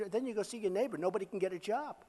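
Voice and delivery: ordinary delivery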